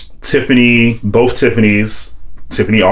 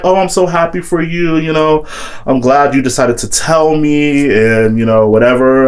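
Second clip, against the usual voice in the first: higher, imitated gushing delight; gushing friend; 6 semitones higher than usual. Friend hearing he's in love, mock gushing happiness. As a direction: play the friend overjoyed for him.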